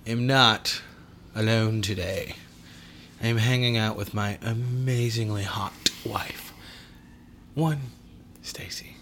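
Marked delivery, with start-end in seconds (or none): 3.2-9.0 hushed whisper. Real, not acted. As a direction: whisper it like a secret. Whispered intro of wife.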